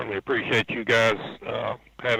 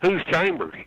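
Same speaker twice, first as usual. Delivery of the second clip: higher-pitched, mock-baffled question; retold bafflement; 7 semitones higher than usual. Crewman who never heard the name, baffled. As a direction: ask it as if the name means nothing.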